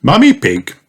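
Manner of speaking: ordinary delivery, nothing marked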